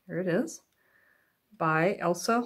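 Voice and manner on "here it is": plain reading voice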